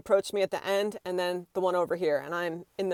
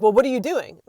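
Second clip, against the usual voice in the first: loud, higher imitation of a nosy stranger; brusque challenge; 4 semitones higher, 8 dB louder than usual. Stranger challenges a shopper who is filming, brusque. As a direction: demand an explanation, mimicking him. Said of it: "he doesn't introduce himself".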